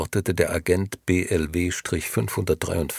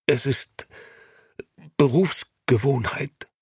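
stammering; 4 semitones higher than usual. A narrator's reading. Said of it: "stammelte der Angeklagte".

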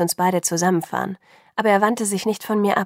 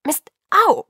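much higher, louder yelp; pained protest; 10 semitones higher, 7 dB louder than usual. A narrator's reading. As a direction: cry out in pain as you fall. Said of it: "protestierte ich".

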